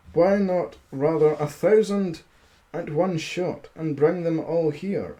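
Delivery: steady storybook reading voice; story narration. A narrator's reading. Streamer reading a fairy tale to his chat, storybook narration. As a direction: read the fairy tale aloud to listeners.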